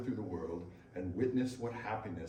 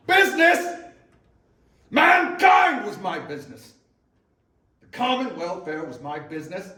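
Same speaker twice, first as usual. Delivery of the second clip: thundering, much higher and louder shout; anguished roar; 7 semitones higher, 15 dB louder than usual. Acted. Marley's ghost rebukes Scrooge for calling him a man of business, roaring in anguish. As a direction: bellow it in torment.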